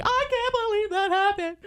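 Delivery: high overwhelmed squeal; mock panic. Retelling her panic attack after meeting the band, mock hysterical squeal. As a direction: squeal it in hammed-up disbelief. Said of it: "fucking panic attack because I'm just like".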